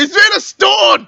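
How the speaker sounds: high, loud, slow shout. Acted. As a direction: yell the bad news. Shouting the news to companion.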